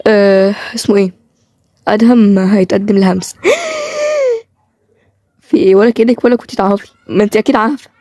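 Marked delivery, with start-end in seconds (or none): 3.4-4.4 shocked gasp. Acted. Shocked by engagement news.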